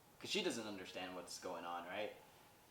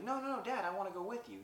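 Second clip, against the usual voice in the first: put-on high, louder child's protest; pleading son; 9 semitones higher, 4 dB louder, faster than usual. Son begging dad to take him along, pleading. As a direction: blurt out an eager objection.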